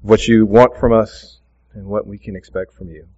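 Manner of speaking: low, soft, hushed prayer voice. Real, not acted. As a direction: pray quietly and earnestly, fading toward amen. Closing prayer before the sermon.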